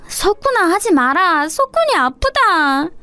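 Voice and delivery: put-on high, slow, simple childlike voice; a young girl's sweet, slow pity; childlike comforting girl. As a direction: comfort him like a small child, slowly and high.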